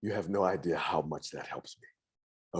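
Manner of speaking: low, soft, slow relief; sighing relief. Acted. Patron thanking the druid for foreseeing success, with sighing relief. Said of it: "He visibly sighs".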